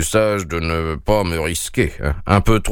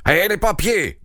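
growled demand; 10 semitones higher than usual. A narrator's reading. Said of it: "gronda le roi".

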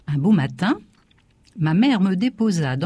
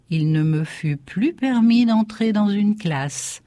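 plain reading voice

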